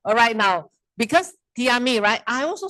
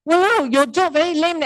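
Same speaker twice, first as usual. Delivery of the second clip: mock scolding; 5 semitones higher, 4 dB louder than usual. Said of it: "I scold the".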